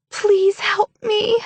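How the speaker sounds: high, slow whimpered plea. Acted. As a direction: whimper it slowly, pleading. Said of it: "dropped her voice into a whimper".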